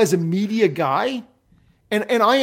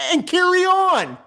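exasperated dismissal; 11 semitones higher than usual. Pretend. Dismissing the absent writer.

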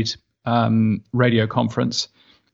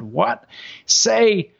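high, drawling American voice, puzzled; American shop worker voice; 8 semitones higher, slower than usual. Retold or imitated. Shop worker in Saint Louis, puzzled, in a high American accent. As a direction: put on a broad American accent asking questions. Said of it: "And they said".

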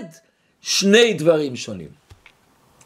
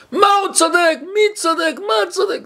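much higher, indignant shouting questions; wife yelling back at her husband in outrage; outraged yell; 11 semitones higher than usual. Retold or imitated. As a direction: fire back in disbelief. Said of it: "צעק, צעק".

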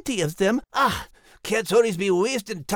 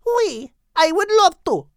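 put-on higher, French accent, exasperated with men; Henriette agreeing; 9 semitones higher than usual. A narrator's reading. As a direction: complain to a friend in French accent. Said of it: "said Henriette".

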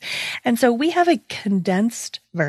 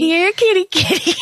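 high, sing-song here kitty kitty, a bit louder; imitating a cat call; 12 semitones higher, 5 dB louder than usual. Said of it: "curling her little finger and leaning in and saying".

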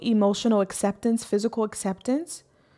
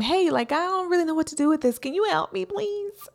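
pleading prayer; 8 semitones higher than usual. Pretend. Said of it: "ask him".

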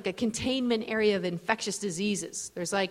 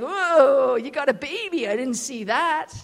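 put-on loud, higher drunken surprise; drunk guy; 4 semitones higher, 5 dB louder, slower than usual. Drunk man noticing the baby he bumped, slurred surprise. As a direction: start on a long loud whoa, then drawl it. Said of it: "drunk native guy".

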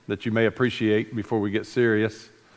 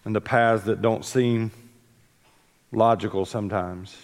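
prayer; 3 semitones lower than usual. Real, not acted. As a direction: pray gently over the congregation. Opening prayer before the sermon.

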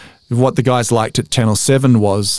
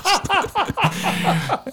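high laughter; 7 dB quieter than usual. Real, not acted. Guest cracks up at his own Ferrari joke, high laugh. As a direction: laugh breaking out high and soft.

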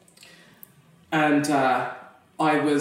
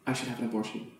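hushed thought; 4 semitones lower, 11 dB quieter than usual. Retold or imitated. Inner thought, confessed hushed.

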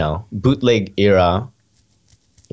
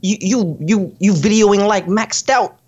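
hypothetical jab in a much higher pitch; higher-voiced pretend remark, stretched at the end; mock teasing compliment; 11 semitones higher than usual. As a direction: pitch it up, as if telling a friend to his face. Mock remark to a rapper acquaintance.

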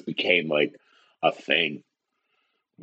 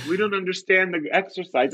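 mock whining; 9 semitones higher than usual. Acts reluctant cousins complaining.

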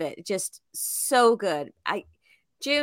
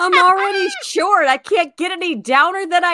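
joking shout; 6 semitones higher, 7 dB louder than usual. Pretend. Joking reply to boxer taunt.

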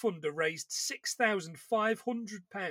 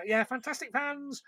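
Frank Sidebottom catchphrase; 6 semitones higher than usual. Retold or imitated. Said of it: "as Frank used to say".

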